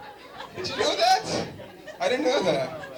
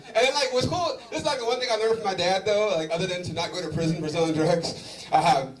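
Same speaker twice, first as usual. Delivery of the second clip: ordinary delivery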